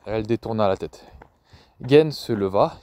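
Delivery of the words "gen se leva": plain reading voice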